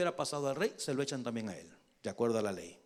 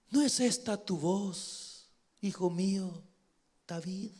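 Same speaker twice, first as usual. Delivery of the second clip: put-on tender old king; 5 dB quieter than usual. Saul recognizing David's voice, tender and hushed.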